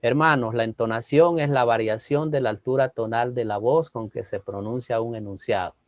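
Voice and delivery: low, level drone without variation; monotone. Shown as a demonstration. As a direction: recite it like a sermon that puts people to sleep. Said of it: "Imagínense yo hablando así por treinta minutos".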